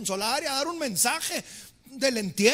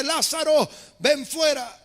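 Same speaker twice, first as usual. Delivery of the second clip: louder, firm call of the name; 2 semitones higher, 5 dB louder, slower than usual. Retold or imitated. Jesus shouting to the dead Lazarus, loud. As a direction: command the dead to come out. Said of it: "Jesús le grita al difunto".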